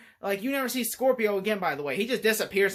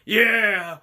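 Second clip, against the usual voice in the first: mock Jax grunt; 2 semitones higher, 7 dB louder than usual. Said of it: "walking around doing this".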